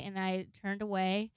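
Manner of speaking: ordinary delivery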